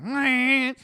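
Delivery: high loud nasal meh; goose honk. Retold or imitated. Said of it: "George is like".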